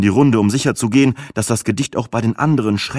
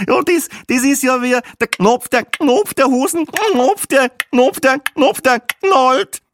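much higher, louder squealing mock of a click language; shrill mocking squeal; 4 dB louder, slower than usual. Tipsy tourist woman mocks the click language, squealing high and loud.

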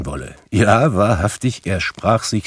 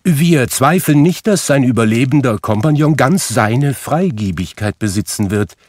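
high, louder, courteous portly gentleman; visiting gentleman politely asking for Scrooge, much higher; 6 semitones higher, 4 dB louder than usual. Acted. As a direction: politely address the master of the office.

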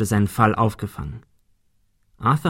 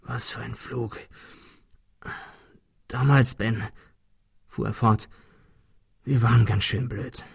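much softer, faint voice after a fall; dazed injured Thomas talking to Ben, faint; weak and dazed; 5 dB quieter than usual. Acted. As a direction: speak like you barely came round.

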